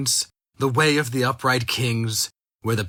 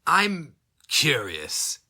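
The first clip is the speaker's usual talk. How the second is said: drawn-out, languid and cold; slow menacing villain; 6 semitones higher, much slower than usual. Acted. Captor toying with his prisoner, slow and cold. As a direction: savor each word like a threat.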